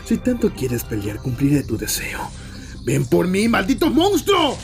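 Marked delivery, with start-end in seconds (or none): 2.8-4.6 loud, rising war cry; battle challenge. Acted. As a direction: shout climbing to a high scream. Challenging the monster to fight.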